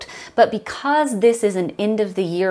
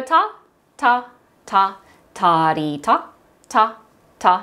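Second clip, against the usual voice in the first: evenly spaced beats with clean gaps; rhythm syllables. Shown as a demonstration. Teacher leads class from the top, chanting. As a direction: chant the rhythm in steady time. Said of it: "how you're going to speak this".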